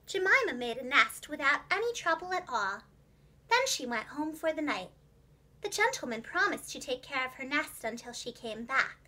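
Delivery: plain reading voice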